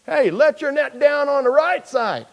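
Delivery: high, loud holler; calling out. Acted. Preacher playing Jesus shouting to fishermen, high loud call.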